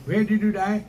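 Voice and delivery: a hint of heavenly gatekeeper; questioning gatekeeper. Acts gatekeeper questioning the dead.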